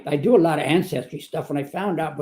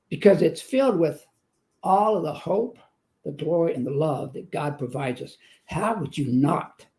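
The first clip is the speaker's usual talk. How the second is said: ordinary talk, nothing marked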